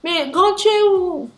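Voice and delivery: high, loud and pushy on the phone; eager phone call. Retold or imitated. Thief on the phone urging her to come, high and loud. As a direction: sound like you're urging someone to hurry over.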